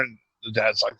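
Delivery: ordinary delivery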